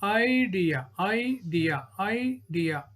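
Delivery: "idea" broken as i-dea; split into syllables. Shown as a demonstration. Teacher showing two syllables, "idea" split each time.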